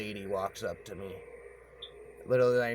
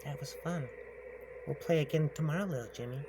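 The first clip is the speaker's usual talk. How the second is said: witch voice; 5 semitones higher, faster than usual. Retold or imitated. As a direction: tease him in a high witch voice. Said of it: "And she goes".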